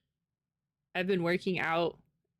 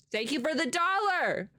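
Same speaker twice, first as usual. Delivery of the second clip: ordinary delivery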